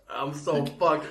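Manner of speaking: usual speaking voice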